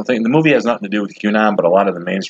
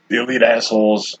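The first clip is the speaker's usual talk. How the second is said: usual speaking voice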